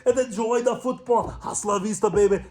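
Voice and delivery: high Austrian action-hero sign-off; Arnold Schwarzenegger impression. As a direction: drop the line like the Terminator. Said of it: "Arnold Schwarzenegger, he has taken over".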